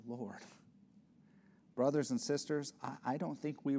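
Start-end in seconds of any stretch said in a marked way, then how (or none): 0.0-0.4 a tired sigh to heaven; weary sigh. Real, not acted. Preacher confessing his own failing, with a sigh. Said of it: "if there's an area I need to take heed to".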